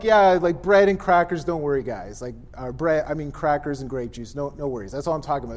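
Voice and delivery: offhand reassurance. Acted. Imagined Jesus soothing the guys, offhand and dismissive. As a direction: play it down as nothing to fuss over. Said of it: "he's not like".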